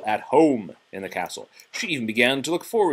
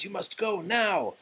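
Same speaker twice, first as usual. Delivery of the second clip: high, hushed plea to leave; urgent warning; 9 semitones higher, 3 dB quieter than usual. Acted. Father in the dungeon warning Belle to flee. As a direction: warn her off gently but firmly. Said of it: "Maurice warned".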